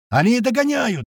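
high, urgent yell; yelled warning. A narrator's reading. Crewman warning of pursuers, high yell. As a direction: cry out the alarm high. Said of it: "орёт Крот".

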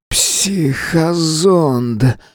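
a very slow slurred croak; hoarse wheeze. A narrator's reading. Drugged earthling croaks out the probe's name, slurred wheeze. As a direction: force the word out through a failing throat. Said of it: "неразборчиво прохрипел землянин".

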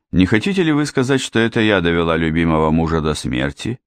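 ordinary delivery

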